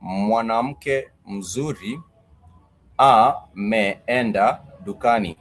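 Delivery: each word drawn out slowly for learners; slow, careful demo of the sentence; slow model sentence. Shown as a demonstration. Teacher models the Swahili sentence slowly for students. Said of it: "you might say".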